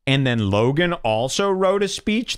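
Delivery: ordinary talk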